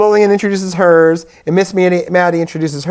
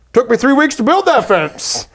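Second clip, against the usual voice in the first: lightly voiced old man, higher; a hint of a grumpy old farmer; old man grumbling; 6 semitones higher than usual. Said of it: "the old man finally speaks. He goes".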